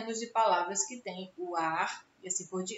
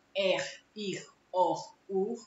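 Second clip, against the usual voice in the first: usual speaking voice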